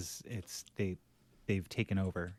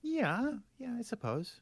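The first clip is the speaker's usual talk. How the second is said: high-pitched, hesitant agreement; not very confident; 14 semitones higher, slower than usual. Real, not acted. Dad answering whether his son handled Mother's Day, unconvinced. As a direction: agree doubtfully, voice pitched right up. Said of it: "you don't sound very confident".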